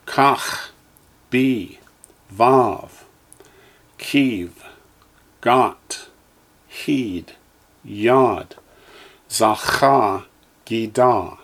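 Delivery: each syllable said slowly and clearly, with a pause after it; teacher's careful drill voice; Hebrew syllables for echoing. Shown as a demonstration. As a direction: model every Hebrew sound for the class to repeat. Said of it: "echo me, please".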